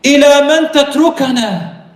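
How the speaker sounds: high, loud, anguished question; pleading cry. Acted. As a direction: beg him to answer. Abandoned wife pleading.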